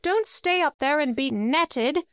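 high-pitched, restrained shout; urgent call upward at ordinary volume; shouted warning. A narrator's reading. Bilbo warning dwarves on the branch, restrained shout. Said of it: "he shouted to the dwarves".

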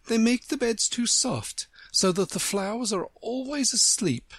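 high storybook flower, explaining; knowing Tiger Lily. A narrator's reading. Tiger Lily explaining sleeping flowers to Alice, knowing.

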